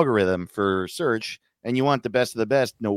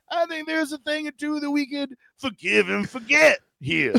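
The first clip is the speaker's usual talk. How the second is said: Southern elder voice. Pretend. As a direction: scold gently like a Southern granddad. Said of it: "Google South is like".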